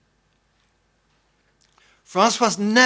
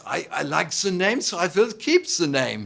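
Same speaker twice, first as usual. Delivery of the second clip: hammed-up Austrian accent; exaggerated Austrian accent. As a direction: imitate a friend's heavy Austrian accent. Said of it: "he said in his exaggerated Austrian accent".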